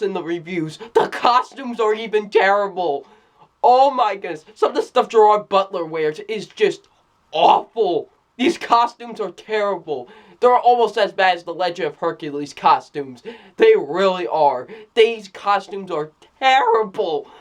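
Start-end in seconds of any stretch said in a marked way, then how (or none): none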